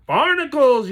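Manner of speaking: high, loud exclamation; boy yelling as the river takes him. Acted. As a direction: yell it as he's swept away. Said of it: "yelled Cliff".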